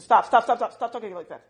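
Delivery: rapid, insistent cut-off, bursting in loud; interrupting protest. Retold or imitated. Hairdresser cutting off a client running down their hair, insistent. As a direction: stop them mid-complaint with quick repeats. Hairdresser cuts off the self-criticism.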